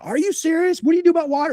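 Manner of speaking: very high, incredulous client voice; imitating someone thrilled and disbelieving; amazed questioning. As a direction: pitch way up in amazement. Client's amazed reaction, acted.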